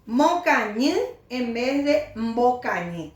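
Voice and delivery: Mocañi with a plain m, then mbocañi with mb; plain m start on Mocañi; plain m versus mb. Shown as a demonstration. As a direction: contrast the m in Mocañi with mb. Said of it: "Cuál es el que suena bien".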